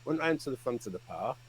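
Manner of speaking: ordinary talk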